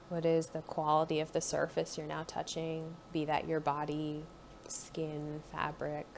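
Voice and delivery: calm guided body-scan voice; guided meditation. Acted. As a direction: ease the listener inward, gently.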